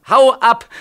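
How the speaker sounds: loud, high "Hau ab!" flung out; man yelling at his inner voice to go away; angry shout. Acted. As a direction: drive him off with a yell. Driving away the other.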